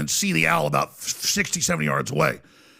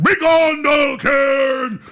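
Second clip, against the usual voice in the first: booming high ritual shout; loud, drawn-out mock proclamation; Walter Cronkite; 9 semitones higher, 6 dB louder, much slower than usual. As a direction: bellow it like a ceremonial banishing. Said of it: "it was the voice of Walter Cronkite".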